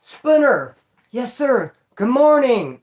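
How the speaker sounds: high, loud call down the tier; calling out at roll call. Retold or imitated. Answering roll call in the hole, a loud high call-out. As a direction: call out your name so the guards hear. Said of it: "And I do".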